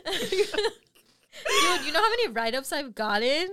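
can't stop laughing while asking. Real, not acted. Amused at all her write-ups, she laughs through it.

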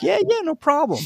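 very high mock cheerful agreement; agreeable stranger. Stranger agreeing to the student filmmaker, high cheerful mock voice. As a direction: squeak out a breezy yes.

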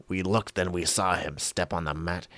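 ordinary talk, nothing marked

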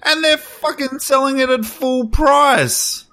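worked-up rant, pitched high. Real, not acted. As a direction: rage about the game. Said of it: "Are you gonna let me rage?".

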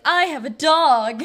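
hammed-up declaiming, every word stretched; Dorothy. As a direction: proclaim it like a clueless heroine improvising. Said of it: "she's probably like".

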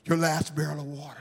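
sudden hush. Acted. Climax drops to hush.

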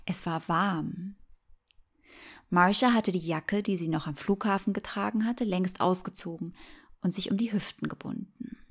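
ordinary delivery, nothing marked

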